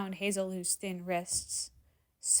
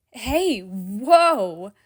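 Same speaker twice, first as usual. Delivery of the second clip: startled shout; 9 dB louder than usual. A narrator's reading. Startled as she clings.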